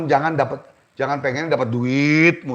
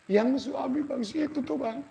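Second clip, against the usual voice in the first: timid wife; 10 semitones higher, 11 dB quieter than usual. Acted. Wife names the abusive husband.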